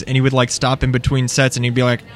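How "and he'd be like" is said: normal talking voice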